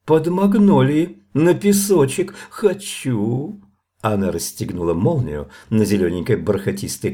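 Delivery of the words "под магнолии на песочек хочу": high, drawn-out spoiled pleading; petulant singsong, rising at the end; capricious demand. A narrator's reading. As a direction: whine for it, pitched well up. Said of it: "провозгласила она немного капризно".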